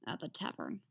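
low, soft gruff mumble; reluctant gruff addition. A narrator's reading. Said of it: "he says gruffly".

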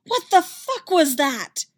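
high, loud furious yell. A narrator's reading. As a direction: scream it in outrage. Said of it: "she yelled".

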